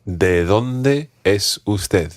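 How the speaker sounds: ¿De dónde es usted? said slowly and clearly; careful, deliberate model; slow teaching model. Shown as a demonstration. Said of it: "ask a slightly different question".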